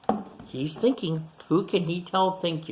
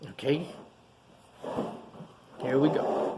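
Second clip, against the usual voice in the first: usual speaking voice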